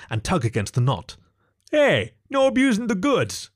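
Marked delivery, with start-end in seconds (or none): 1.7-3.5 high-pitched yelped complaint; mock indignant protest. A narrator's reading. Fox objects to a smack, high and indignant. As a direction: protest in a much higher voice.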